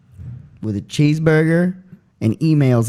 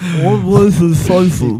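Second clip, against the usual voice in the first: slow mock Statham; 4 semitones higher, 3 dB louder, slower than usual. Statham impression again.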